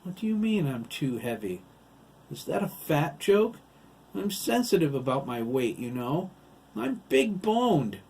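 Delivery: high, loud offended voice; indignant protest. Acted. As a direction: protest touchily about being called heavy. Heavy character protests a weight remark.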